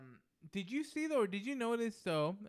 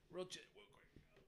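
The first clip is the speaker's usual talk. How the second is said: barely awake, fading into sleep; nodding off; 4 semitones higher, 13 dB quieter than usual. Real, not acted. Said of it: "I feel like I'm falling asleep".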